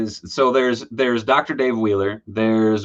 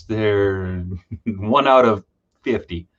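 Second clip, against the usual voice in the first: ordinary talk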